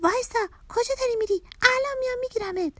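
high, urgent yell; shouted. A narrator's reading. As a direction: call after someone fleeing, fast and high-pitched. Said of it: "داد زد".

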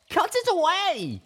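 high, loud, slow tearful demand; frantic shout. Acted. Little Pip screaming at Velvet to cut it away, frantic. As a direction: scream the order at her.

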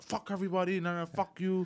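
high, softened mock yelling; retold defiant exit. Kid cursing while walking towards the exit, small high mock yell. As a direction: curse them all as you retreat. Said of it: "as he's walking towards the exit".

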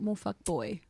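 normal talking voice

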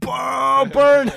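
high, loud gloating yell; triumphant shout. Retold or imitated. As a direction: shout it like you just won. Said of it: "And I was like".

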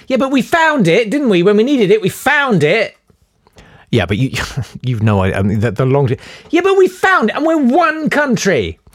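high, pressing comeback; insistent. Real, not acted. Arguing with co-host Jay, insistent high comeback. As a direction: push the point up high and hard. Arguing with cohost over money.

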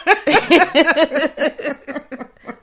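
high, hearty burst of laughter; loud laughter. Real, not acted. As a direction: let out a big laugh.

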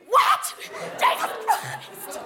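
very high, hammed-up shriek; retold panic, squealed out; acted scream of horror; terrified. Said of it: "I was t-, so terrified".

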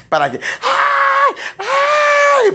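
high piercing mock cry of ay, ay; mock child's wail. Preacher imitating children howling at the rod, mock wail. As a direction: wail ay like a spanked child playing it up. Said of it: "comienzan a gritar".

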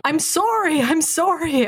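high-pitched imitation Canadian accent; thick Canadian accent. Critic mocking British actor's botched American, thick Canadian accent. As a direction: mimic an actor slipping into Canadian. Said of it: "thick Canadian accents I've ever heard".

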